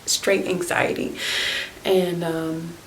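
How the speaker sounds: ordinary delivery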